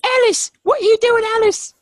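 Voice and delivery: very high, loud British voice; mock British. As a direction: call out like a frantic English cop. Luther impression bit.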